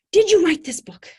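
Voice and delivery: high, loud, breathy eager asking; an animated fan asking the author; excited woman. Retold or imitated. Fan in the car asks the author, high and loud. She repeats question inside car.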